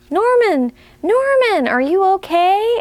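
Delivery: high calling snail voices; small snails checking on their friend; worried snails. Acted. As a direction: call out to Norman like anxious little snails. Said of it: "asked the snails".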